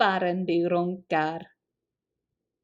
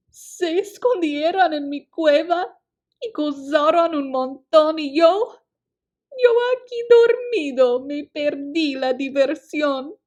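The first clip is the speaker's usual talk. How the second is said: higher, louder sulky bear complaint; whiny sulking bear; 7 semitones higher, 8 dB louder than usual. A narrator's reading. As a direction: whine like a left-out bear. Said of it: "refunfuña y lloriquea".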